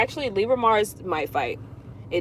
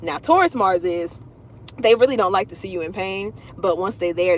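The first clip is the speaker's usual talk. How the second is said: normal talking voice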